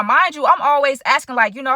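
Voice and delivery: usual speaking voice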